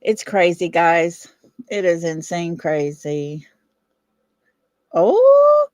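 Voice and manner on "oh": high, loud yelp of surprise, then slow; real delight at the Broncos win; excited surprise. Real, not acted. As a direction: burst out at the score news. Sudden sports news excitement.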